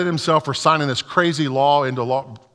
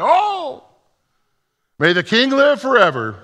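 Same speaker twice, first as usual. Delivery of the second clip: Daniel calling up; 11 semitones higher, 4 dB louder than usual. A narrator's reading. Daniel answers from the den.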